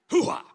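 high, loud hoo-ha shout; loud playful vocal warm-up yell; loud whoop. Real, not acted. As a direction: blast out a cheerful hoo-ha. Clearing throat, testing voice.

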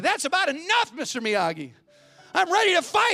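a frustrated pupil demanding to fight; fed-up student. Retold or imitated. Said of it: "finally he comes in frustration".

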